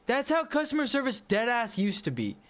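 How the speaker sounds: usual speaking voice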